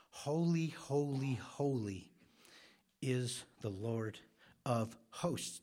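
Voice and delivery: preacher intoning the angels' song of holiness; solemn emphasis. Acted. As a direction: ring out the first holy, then let it descend. Repeating the seraphim's song.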